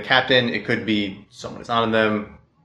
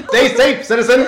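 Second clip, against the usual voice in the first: loud, high heroic announcer voice; 1940s pulp announcer; 13 semitones higher, 8 dB louder than usual. Acted. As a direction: belt it like an old radio hero. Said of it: "Way too loud".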